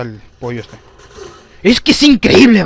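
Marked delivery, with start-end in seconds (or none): 1.6-2.6 high, very loud outburst of disbelief; exasperated shout. Real, not acted. Gamer outraged at dying in game, exasperated shout. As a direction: yell it out in real frustration.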